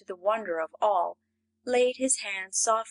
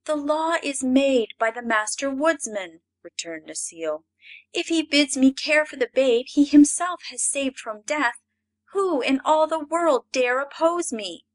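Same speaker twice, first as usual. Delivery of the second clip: defiant nymph; 6 semitones higher, 6 dB louder than usual. A narrator's reading. Necile retorts to Ak.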